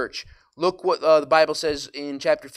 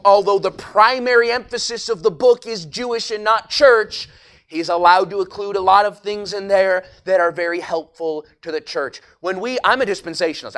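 fervent preaching; 4 semitones higher, 9 dB louder than usual. Acted. Preaching at full force.

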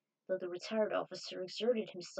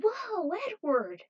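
higher and louder surprised cry; startled whoa; 11 semitones higher, 7 dB louder, slower than usual. Acted. Friend startled by Edward bursting in, surprised shout. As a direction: jump back in surprise.

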